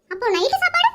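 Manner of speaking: ordinary talk, nothing marked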